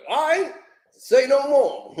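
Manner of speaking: casual Jay brush-off. Retold or imitated. Jay shrugging off the caller, high and breezy. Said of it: "He was like".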